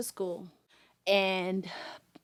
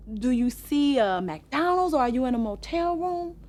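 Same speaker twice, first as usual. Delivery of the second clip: retold frantic pleading; 8 semitones higher, 5 dB louder than usual. Mother frantic on phone call.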